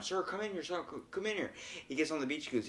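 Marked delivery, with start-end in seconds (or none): 0.0-1.5 mock distant yell. Lifeguard calling a swimmer back to the beach, mock yelling. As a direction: imitate yelling without the volume. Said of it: "like, yelling at him".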